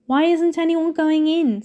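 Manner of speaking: curious schoolboy asking his friends; Ron curious. A narrator's reading. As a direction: wonder aloud over the heads. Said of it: "said Ron curiously".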